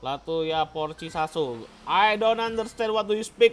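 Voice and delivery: ordinary talk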